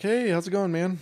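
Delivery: high-pitched put-on greeting; cheery greeter. Someone greeting a water truck driver, mock cheery. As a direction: toss out a light, sing-out hello.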